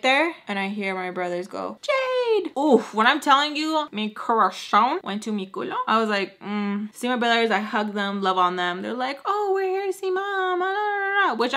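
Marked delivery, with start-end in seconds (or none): 1.8-2.5 high, drawn-out name call; excited kid calling. Retold or imitated. Brothers greeting their sister, high-pitched call. 9.2-11.3 high singsong child imitation; mock excited kids. Brothers glad to see mom, mock singsong.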